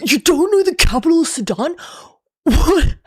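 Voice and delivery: high, incredulous mock outrage; mocking know-it-all replier. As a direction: jeer in a put-on high voice, appalled at such ignorance. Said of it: "without someone replying".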